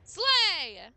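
loud, high cheer of slay; hyped slay. Real, not acted. Streamer cheering a chat name, loud and high. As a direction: cheer the viewer on.

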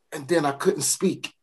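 ordinary delivery

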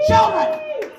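sudden high yell of the one word; shouted outburst. Acted. Comic to the crowd, shouted outburst. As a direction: bellow it at the crowd in disbelief. Comic rant about the restaurant.

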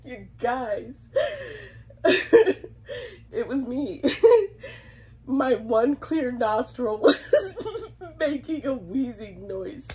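helpless laughing fit, voice climbing and loud; words breaking up into teary giggles; crying with laughter. Real, not acted. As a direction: barely get the words out through real laughter. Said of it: "I'm crying 'cause it's just so funny".

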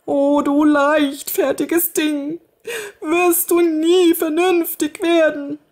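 high, tearful scolding; crying nursemaid. A narrator's reading. Kinderfrau schimpft weinend mit dem Mädchen. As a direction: sob out the reproach. Woman cries over the girl.

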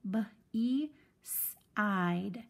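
B, E, side with a long E; teacher blending beside; sounding out. Shown as a demonstration. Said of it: "we read it as a long vowel".

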